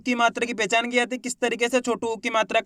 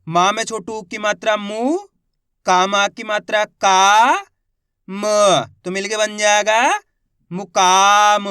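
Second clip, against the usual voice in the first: stretched syllables, then the word louder; slow sounding out; 4 dB louder, slower than usual. Shown as a demonstration. Teacher sounding out a word for beginner readers, slowly. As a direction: drag each syllable, then land the word. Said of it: "teacher sounds out word".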